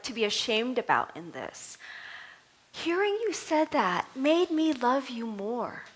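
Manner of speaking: ordinary delivery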